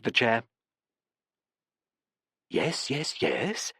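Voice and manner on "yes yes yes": probing voice. A narrator's reading. Professor probes Barbara, soft and coaxing. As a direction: gently press her for more. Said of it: "the probing voice of Professor Rigo".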